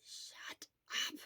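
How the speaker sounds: soft unvoiced whisper; weak whisper. Weak patient whispering at his chattering friend. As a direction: hush him with barely any voice.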